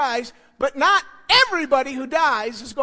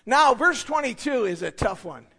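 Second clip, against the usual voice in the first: usual speaking voice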